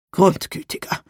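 cracking tearful heartbreak; voice breaking. A narrator's reading. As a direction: let the voice crack on it. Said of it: "Seine Stimme brach". (brechende Stimme)

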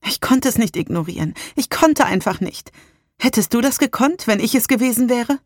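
lightly voiced Salem, rising to a plea; a touch of tearful pleading.

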